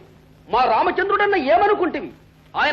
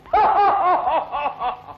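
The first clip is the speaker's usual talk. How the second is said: long theatrical ha-ha-ha; mocking stage laugh. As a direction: laugh mockingly at the rival king. Mocking Ravana from higher seat. (ఎగతాళిగా నాటకీయమైన నవ్వు)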